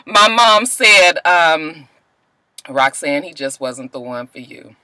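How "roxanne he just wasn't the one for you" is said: gentle mom. Retold or imitated. Mom gently consoling her.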